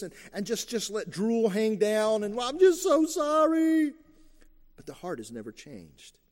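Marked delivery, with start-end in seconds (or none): 2.3-3.9 high, loud hammed-up weepy whine; mock weeping. Believer fakes sorrow to God, hammed-up weeping whine. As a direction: parody a slobbering fake apology. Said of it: "to cry and even to weep and to slobber".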